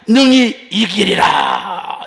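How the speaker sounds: slow, loud triumphant proclamation; proclaiming shout. Retold or imitated. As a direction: cry it out like a victorious declaration. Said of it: "이렇게 선포했습니다".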